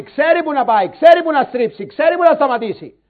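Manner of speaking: loud, punchy pulpit rhythm; preaching at full force. Acted. Preacher telling Christians when to help, forceful preaching. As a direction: press each line like a command. Preacher drives home the point.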